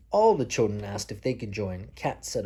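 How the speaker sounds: usual speaking voice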